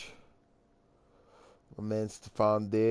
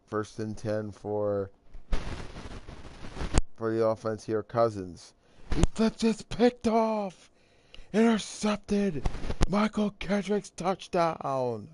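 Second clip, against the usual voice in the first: play-by-play call; 5 semitones higher than usual. Pretend. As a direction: announce the pick-six like a stadium broadcaster. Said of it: "I'm gonna do my play-by-play".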